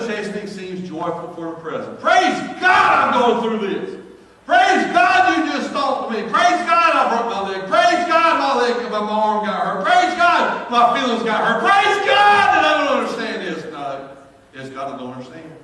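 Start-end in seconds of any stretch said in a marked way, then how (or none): 2.0-13.6 loud, high, rising praise cries; shouting praise to the congregation; preaching at full force. Acted. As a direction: preach it out, building the fervor.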